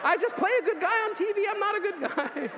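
high loud laughing insistence; joking confession. Real, not acted. Preacher joking loudly to the congregation he is no good guy. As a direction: shout it as a playful confession. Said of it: "I'm telling you right now".

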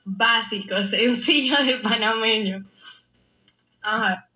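ordinary talk, nothing marked

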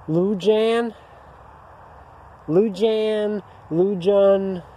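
Lujan tried out high, unsure, like a guess at the name; unsure pronunciation. Real, not acted. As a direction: fumble Lujan as a questioning guess. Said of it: "I'm probably gonna butcher its name".

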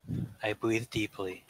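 usual speaking voice

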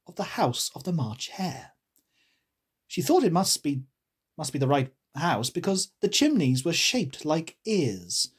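usual speaking voice

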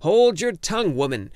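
loud high shout, slowing on the last words. A narrator's reading. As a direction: yell down the bossy wife. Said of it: "he shouted".

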